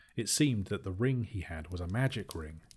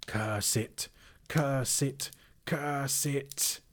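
rising hissed curses, getting higher; hissing Gollum; 6 semitones higher, much slower than usual. Acted. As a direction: spit it out like a creature. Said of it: "hissed Gollum".

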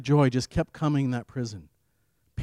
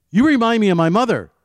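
raised and louder, pitched up, booming out; 9 semitones higher, 11 dB louder than usual. Retold or imitated. Fellow prisoner tells the preacher he reminds him of mother, loudly. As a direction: call it out loud and high like a rough inmate. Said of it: "kind of a loud voice".

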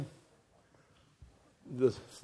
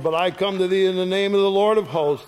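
fast, raised preaching voice; bold proclamation; 4 semitones higher, 5 dB louder, faster than usual. Acted. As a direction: declare it like a battle cry. David's proclamation read aloud.

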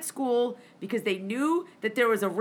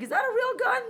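much higher, disbelieving "Is that a real gun?"; incredulous question; 11 semitones higher than usual. Retold or imitated. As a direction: squeak it out in shock.

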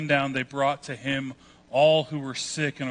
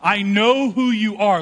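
loud, higher acted yell; imitating a demon-possessed man's outburst; retold yelling; 6 semitones higher, 6 dB louder than usual. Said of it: "But he starts yelling".

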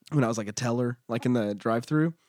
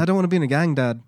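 put-on British accent; 3 semitones higher, faster than usual. Correcting a co-host's impression, high British accent. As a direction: do a British son protesting. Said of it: "I think he's more British".

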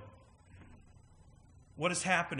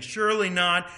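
a louder, indignant retort; angry protest; 4 semitones higher, 8 dB louder than usual. Retold or imitated. Pharisees answering Jesus's parable, angry and loud. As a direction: snap it back, offended. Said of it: "these people are ticked, and they're angry".

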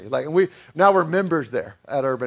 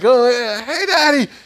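excited shout; 7 semitones higher, 7 dB louder than usual. Retold or imitated. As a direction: call out to daddy from the zip line.

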